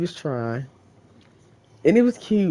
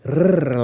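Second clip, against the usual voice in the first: loud trilled R; long, punchy tongue trill; rolled R; 7 dB louder than usual. Retold or imitated. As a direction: imitate a hard rolling R sound. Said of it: "rolling the fuck out them R's".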